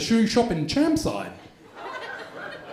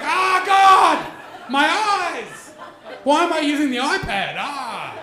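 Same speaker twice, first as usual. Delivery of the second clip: mock horror; 8 semitones higher, slower than usual. Comic seeing the dick pic, high mock-horrified shriek. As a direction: recoil in hammed-up horror. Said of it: "I'm like".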